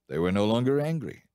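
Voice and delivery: usual speaking voice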